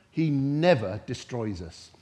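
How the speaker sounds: normal talking voice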